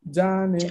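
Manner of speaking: "ya ne" with a crisp Japanese ya; "ya ne" pronounced as in Japanese; correct Japanese. Shown as a demonstration. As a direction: say "ya ne" the proper Japanese way, short and clear. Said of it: "la pronunciación correcta para decir".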